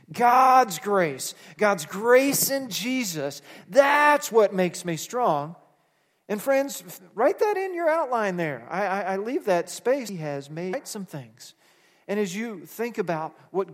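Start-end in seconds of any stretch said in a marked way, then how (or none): 0.1-8.4 loud, slow shouted proclamation; preaching shout. Acted. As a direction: proclaim it at full force. Preaching climax.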